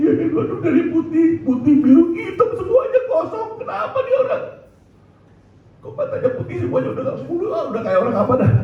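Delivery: very high, squeaky astonished chatter; imitated panicked onlookers. Preacher acts people seeing his lifeless body, high-pitched mock panic.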